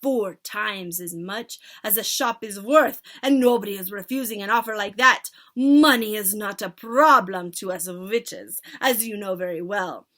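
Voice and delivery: loud witch leader giving orders; commanding witch. Acted. Witch leader instructing her followers, loud. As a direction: command the crowd grandly.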